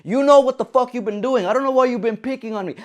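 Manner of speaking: telling off the coworker who smiles it off, angry outburst; pissed off. Retold or imitated. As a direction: lay into him, fast at the end. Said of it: "I'm still pissed laying it on him".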